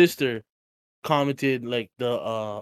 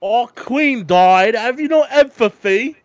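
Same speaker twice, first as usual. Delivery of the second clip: high, slow, hammed-up mourning Brit; mock grief, pitched up and loud; mock British outrage; 10 semitones higher, 8 dB louder, slower than usual. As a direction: play an offended royal fan, drawn out. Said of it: "That's what they're all saying on TikTok".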